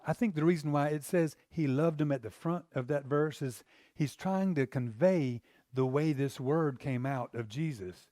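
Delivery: ordinary talk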